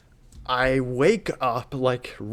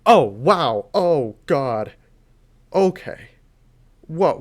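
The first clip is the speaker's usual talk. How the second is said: louder, shocked gasps of alarm; alarmed surprise; 2 semitones higher, 7 dB louder, much slower than usual. Retold or imitated. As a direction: react like the reading is scary. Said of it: "the way that she's talking to me just changes".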